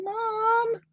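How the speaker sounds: very high tiny dog voice; a small pleading call; squeaky puppy plea; voicing the dog.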